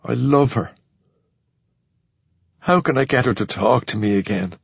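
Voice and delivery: low, subdued pleading; low-pitched, dejected confiding; lovelorn sadness. A narrator's reading. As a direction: confess it low, then ask helplessly. Tearful Carl pleads with ghost.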